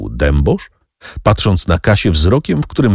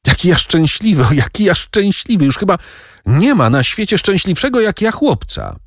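put-on high, bubbling boyish joy; overjoyed boy; 12 semitones higher than usual. A narrator's reading. Boy overjoyed at the news, high excited voice. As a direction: sing out your happiness like a child. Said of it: "z radości".

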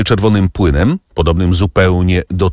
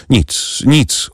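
mildly strained, higher reply; with effort; 9 semitones higher than usual. A narrator's reading. Unwell baroness reassuring Debray, with slight effort. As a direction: let a little effort into the words. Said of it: "odparła z wysiłkiem".

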